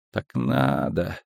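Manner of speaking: low, shaky and slow; trembling voice. A narrator's reading. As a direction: whisper it with a tremble. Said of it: "дрожащим голосом произнесла Цири". (дрожащим голосом)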